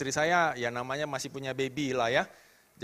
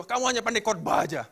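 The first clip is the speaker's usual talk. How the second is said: retold yell; 5 semitones higher, 4 dB louder than usual. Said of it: "Dia teriakin saya kayak gitu".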